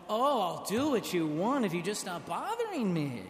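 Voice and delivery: loud, fed-up mock grumble; hammed-up irritation; annoyed tone. Preacher mocks a fed-up, impatient Jesus. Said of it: "I do not think he said it with the tone".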